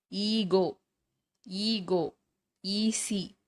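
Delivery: "ஈகோ", "ஈசி" with a long "ee" start; long ஈ demo. Shown as a demonstration. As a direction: sound out "ஈகோ" and "ஈசி" singly, holding the "ee". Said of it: "இது எல்லாமே ஈ". ("ஈகோ", "ஈசி" நீண்ட ஈ ஒலியுடன்)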